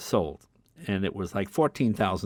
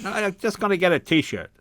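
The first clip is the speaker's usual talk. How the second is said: high, louder stubborn insistence; insistent dad; 10 semitones higher, 5 dB louder than usual. Retold or imitated. Dad insists to his son on buying the T-shirt, stubborn and loud.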